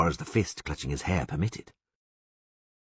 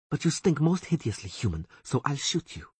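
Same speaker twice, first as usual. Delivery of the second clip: higher, mocking elf woman hissing; 9 semitones higher than usual. A narrator's reading. Elf woman taunting the bound Witcher, higher sneer. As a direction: taunt the bound man, pitched up. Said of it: "continued the elf".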